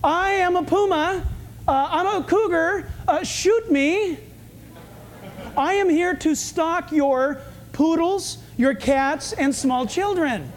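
high, slow mock puma voice; talking mountain lion. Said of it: "You think they, you think they walk through".

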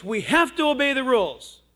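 loud, high, insistent scold; lecturing mother. Retold or imitated. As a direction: imitate a mom laying down the law. Mother lectures boy, retold.

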